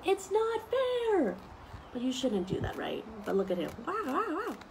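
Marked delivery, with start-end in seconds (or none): 0.0-1.4 drawn-out high whiny protest; mock tantrum. Teacher acting out an angry child, mock tantrum. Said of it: "stomp and roar and shout". 3.9-4.6 high playful wows; mock amazement. Teacher marvelling at a picture for kids, playful wows.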